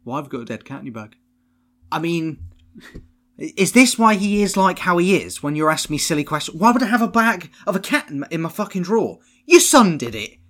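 high, loud, fast exasperated rant; mock ranting principal. Principal confronting the mum about a dead cat, mock rant. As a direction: pile it on like a furious head.

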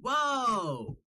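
mock Boston amazement, high and slow; a hammed-up Boston local, stunned and drawn out; Boston accent. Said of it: "Are you from Boston?".

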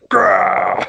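sudden high monster growl; monster roar. Retold or imitated. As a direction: burst in with a beastly roar. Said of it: "crashing through the door".

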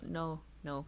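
ordinary talk

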